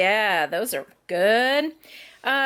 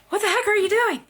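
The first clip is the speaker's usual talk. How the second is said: retold startled cry, higher and louder; startled outburst; 11 semitones higher, 4 dB louder, faster than usual. Mom retelling finding the toddler on the floor, startled cry. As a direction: replay the shock of finding her in the dark. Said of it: "So I'm like".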